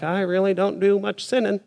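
lightly voiced smug churchgoer; mock self-righteous. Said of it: "the self-righteous person that's like".

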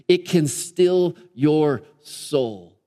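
very slow, spaced-out words; slow and weighty. Acted. Preacher closing a quote on stillness, slow and weighty. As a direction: let each word settle.

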